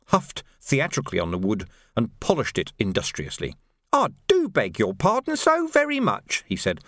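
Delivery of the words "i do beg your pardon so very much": sneering sergeant in a higher put-on voice; mock-polite Carcer. Carcer mocking the officers, falsely polite. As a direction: overdo the false courtesy.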